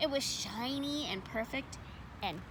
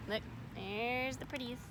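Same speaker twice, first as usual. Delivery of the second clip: plain reading voice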